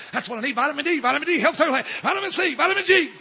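high, loud, climbing enthusiasm; mock eagerness. Preacher mimicking someone starting vitamins, loudly overeager.

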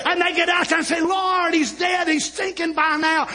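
high, driving sermon delivery; full-force preaching. Acted. Preacher retelling Lazarus' raising to the congregation, fervent preaching. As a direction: preach it at full pitch without letting up.

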